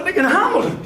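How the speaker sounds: ordinary talk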